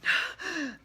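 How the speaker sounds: a sharp, high frightened gasp; scared gasp. Shown as a demonstration. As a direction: gasp like a startled kid. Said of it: "it's the kid".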